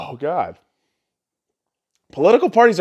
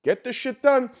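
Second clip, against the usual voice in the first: imitating Trump; 7 semitones higher than usual. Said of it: "Dude is more like".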